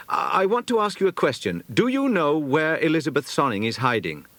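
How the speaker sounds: put-on high, hesitant then pointed boyish question; a lad working up courage; earnest boy. A narrator's reading. Peter confronting the stable boy about Elizabeth, earnest.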